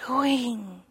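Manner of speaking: breathy hushed dismay, trailing to a whisper; hushed horrified thought; shocked inner whisper. Retold or imitated. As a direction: gasp it to yourself in quiet horror. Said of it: "And I thought".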